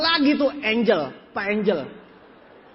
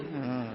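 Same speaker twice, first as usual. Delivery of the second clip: soft, low sleepy "hmm"; drowsy hum; 11 semitones lower, 15 dB quieter than usual. Retold or imitated. Speaker mimics two sleepy audience members, drowsy low hum. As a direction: imitate someone nodding off with a hum. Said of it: "ngantuk-ngantuk gini".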